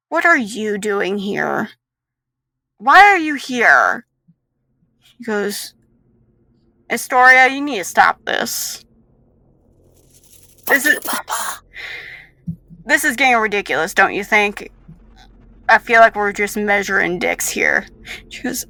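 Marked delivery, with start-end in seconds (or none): 0.1-4.1 acted displeasure, growing louder; annoyed confrontation. Villain confronts unexpected visitor. 6.9-17.8 loud, lightly voiced reproach; exasperated colleague.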